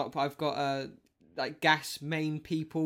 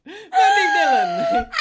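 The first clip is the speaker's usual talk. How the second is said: high shrieking laugh through the name; laughing shout; 14 dB louder, slower than usual. Real, not acted. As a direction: lose it laughing mid-announcement. Shouted intro dissolving into laughter.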